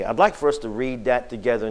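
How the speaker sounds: ordinary talk, nothing marked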